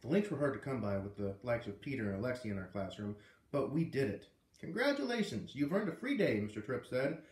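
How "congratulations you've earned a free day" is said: excited. Acted. Teacher announces free day.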